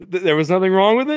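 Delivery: high, fast, disbelieving; high-pitched rushed manager; incredulous manager. Retold or imitated. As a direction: fire the question in disbelief. Manager voiced in retold story.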